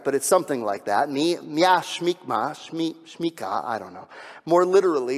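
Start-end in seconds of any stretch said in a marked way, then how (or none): 1.1-3.6 Hebrew phrase "Mi, Mia Shmikma" sounded out slowly, hesitantly; unsure Hebrew attempt. Shown as a demonstration. Preacher trying out a Hebrew phrase, slow and unsure. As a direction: feel your way through "Shmika" syllable by syllable. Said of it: "I don't even know if I'm pronouncing that right".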